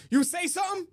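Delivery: high, mock-challenging stranger voice; imitating stranger. Retelling a stranger on the street, high mock challenge. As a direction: throw it out like a stranger picking a fight. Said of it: "and he goes".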